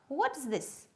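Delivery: ordinary talk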